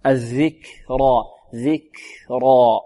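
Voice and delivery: soft, very slow الذكرى with air released after ك; breathed kaf. Shown as a demonstration. Teacher isolating the kaf, airy release in ذكرى. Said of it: "الكاف مهموسة".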